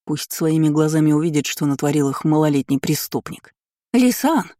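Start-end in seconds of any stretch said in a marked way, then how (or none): 3.9-4.5 high-pitched cry of the name; wailing mother. A narrator's reading. Mother races up to stop her son, wailing. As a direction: call out in a mother's alarmed wail. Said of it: "голосила моя мама".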